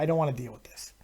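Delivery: hushed reluctant whisper. Retold or imitated. Storyteller whispering his reluctance to the crowd. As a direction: breathe it out, not wanting to deal.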